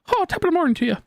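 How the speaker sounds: high-pitched, hammed-up Irish greeting; cartoonish Irish lilt; jokey Irish accent. Shown as a demonstration. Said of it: "Irish accent and be like".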